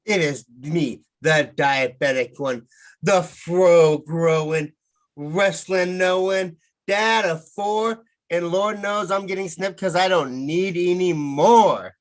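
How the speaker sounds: slow, rhythmic rhyming wrestler promo. Acted. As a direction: deliver it like a ring entrance. Rhyming wrestler self-intro.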